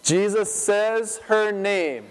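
ordinary talk, nothing marked

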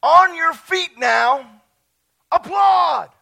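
slow, loud, high command; rousing call. Acted. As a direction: call them to their feet. Reads Message scripture as call.

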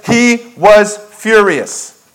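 he was furious, drawn out slowly; slow emphatic reading. Shown as a demonstration. Preacher reading scripture to congregation, slow and weighty. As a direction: let each word land slowly and heavily. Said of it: "Three words".

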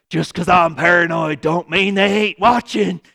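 a lightly voiced defensive paranoid person; paranoid retort. Anxious person answering friends who call them paranoid, mock-defensive. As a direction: toss it back, half-joking and defensive. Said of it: "And you're like".

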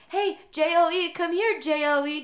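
high, loud mock dog call. Owner pretending to call dog by spelled name, high call. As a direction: pretend to summon a pet by a silly name.

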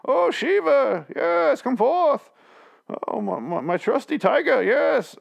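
imitating the actor's grand king voice; Bane voice. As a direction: ham up a medieval king, slowly. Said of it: "He does his Bane thing".

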